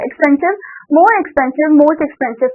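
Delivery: ordinary talk, nothing marked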